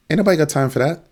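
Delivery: high-pitched sassy exasperation; mock exasperation. Host mocking a "let you know" deal, exasperated.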